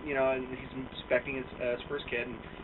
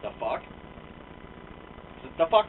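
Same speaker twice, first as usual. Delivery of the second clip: normal talking voice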